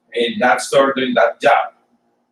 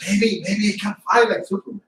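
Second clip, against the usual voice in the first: put-on higher-pitched, wondering kid's thought; childhood wishful thought; 7 semitones higher than usual. Teacher recalling childhood dream of flying, lightly acted wonder. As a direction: lightly voice a child's hopeful daydream. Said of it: "At one point I thought".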